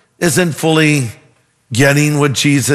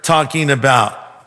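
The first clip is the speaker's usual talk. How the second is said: mock-sarcastic woman, pitched up at first; 4 semitones higher than usual. Woman at the well answers Jesus, sarcastic. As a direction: put a sarcastic sneer on the request. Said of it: "She's being sarcastic".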